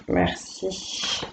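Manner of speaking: soft breathy merci to the cards; whispered thanks. Real, not acted. Reader thanks the cards after drawing for Aries, whispering. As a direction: whisper a quiet thank-you.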